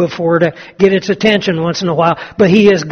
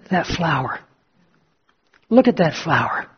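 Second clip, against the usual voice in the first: hushed wonder; 7 dB quieter than usual. Acted. Old Adams quietly points out flower.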